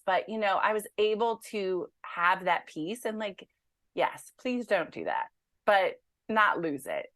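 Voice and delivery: normal talking voice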